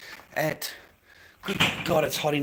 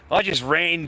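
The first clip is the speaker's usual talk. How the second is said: ordinary talk, nothing marked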